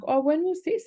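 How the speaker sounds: high, surprised when was this; a high little surprised oh; surprised question. Retold or imitated. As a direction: caught off guard, asking when. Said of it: "And I'm like".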